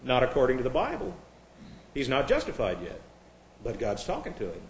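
ordinary delivery

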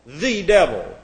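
"the" stressed as its own word before "devil"; emphatic, separated "the" in "the devil". Shown as a demonstration. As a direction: point up the article "the". You have an article before.

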